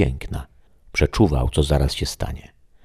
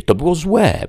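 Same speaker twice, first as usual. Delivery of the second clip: much higher, louder indignant outburst; 5 dB louder than usual. Acted. The shadow protests to the voice, much higher and indignant. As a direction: protest the injustice. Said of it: "Oburzył się".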